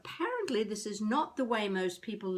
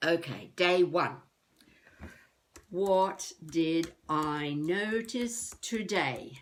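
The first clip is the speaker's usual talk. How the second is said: slow diary recital; 2 semitones lower, slower than usual. Acted. Mock-formal diary entry.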